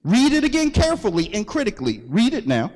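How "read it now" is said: usual speaking voice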